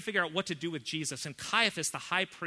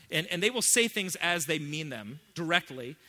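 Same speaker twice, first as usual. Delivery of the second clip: normal talking voice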